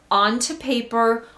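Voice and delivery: ordinary talk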